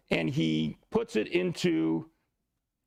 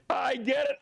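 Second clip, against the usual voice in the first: laughing; 8 semitones higher than usual. Real, not acted. Laughing at a student's joke.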